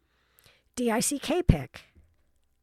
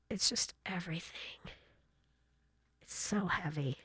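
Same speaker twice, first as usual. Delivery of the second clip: whispered aside; 3 semitones lower, 10 dB quieter than usual. Real, not acted. Heavy aside about phones.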